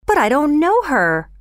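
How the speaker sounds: put-on high-pitched boyish protest; greatly alarmed. A narrator's reading. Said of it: "Oliver replied, greatly alarmed".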